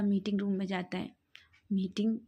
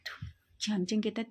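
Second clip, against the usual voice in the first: ordinary delivery, nothing marked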